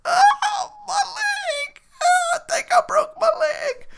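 high mock whimpering wail over a broken leg. Injured player wailing to the team, high mock cry. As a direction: ham up a squealing cry of pain.

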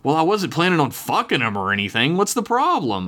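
high, defensive protest; indignant query. A narrator's reading. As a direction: demand to know what's wrong. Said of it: "I queried".

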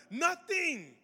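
high, drawn-out thrilled answer; amazed excitement. Retold or imitated. Healed kid answers about his back pain, high and excited. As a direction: imitate a kid overjoyed at the discovery.

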